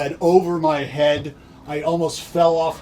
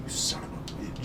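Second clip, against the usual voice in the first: voiceless, much softer curse to himself; muttered curse under breath; 3 semitones lower, 13 dB quieter than usual. Retold or imitated. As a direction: swear under your breath, barely voiced. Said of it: "I'm thinking to myself".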